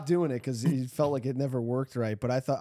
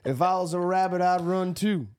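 imitated Southern soldier's drawl; 2 semitones higher than usual. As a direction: quote the soldier in a put-on Southern accent. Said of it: "he had, like, a southern drawl".

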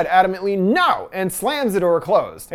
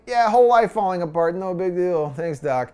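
lightly scoff, then let it sag into a deadpan mumble; sarcastic brush-off, fading away. A narrator's reading. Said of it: "Rose scoffs".

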